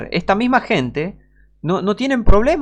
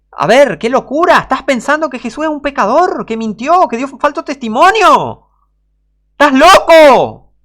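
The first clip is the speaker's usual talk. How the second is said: rising outrage, then hammer the final cry; indignant outburst; 10 semitones higher, 8 dB louder than usual. Acted. Preacher shouting at heretics in outrage.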